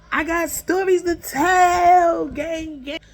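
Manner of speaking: high, loud, stretched-out holler; drawn-out catchphrase shout. Pretend. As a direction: belt out your slogan to the followers. Signature catchphrase at sign-off.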